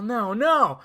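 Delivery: acted cry of protest. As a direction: call out in imitated disbelief. Acts Rocket watching Groot go.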